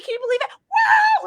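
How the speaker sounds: high giddy question, then a whoop; crazy-person elation. Retold or imitated. Repeating disbelief to partner like a crazy person, manic elation. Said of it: "I was, like, a crazy person".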